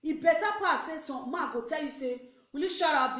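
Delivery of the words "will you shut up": loud, raised mock outburst; loud, indignant telling-off; angry scolding. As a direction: scold like an insulted man. Imagined man scolding a woman.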